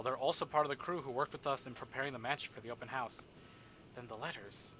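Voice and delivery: normal talking voice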